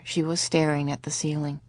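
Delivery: ordinary delivery, nothing marked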